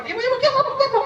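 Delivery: calling ibu in a high panicked voice; a husband panicking at a deathbed; frantic calling out. Acted. Husband calls his wife in a high panic.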